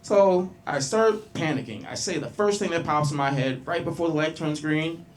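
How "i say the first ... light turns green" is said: ordinary delivery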